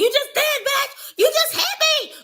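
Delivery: very high, loud mimicked whine; mocking Daisy. Reactor mimicking Daisy's complaint to viewers, mocking squeal. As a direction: squeal it in mock outrage, far above your own pitch. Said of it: "Daisy talked about something".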